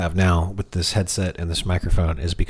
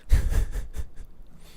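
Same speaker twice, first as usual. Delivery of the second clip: breathy laugh; 3 dB quieter than usual. Real, not acted. Laughs after voice demo.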